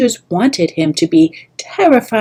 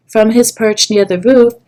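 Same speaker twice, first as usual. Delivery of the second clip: ordinary delivery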